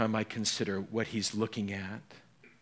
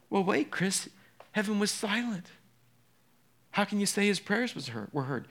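higher, doubting challenge; a listener challenging the preacher; skeptical objection; 6 semitones higher than usual. Pretend. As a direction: push back like a puzzled listener.

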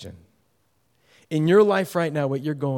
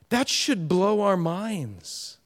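usual speaking voice

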